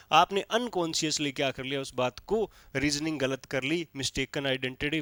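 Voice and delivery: ordinary talk, nothing marked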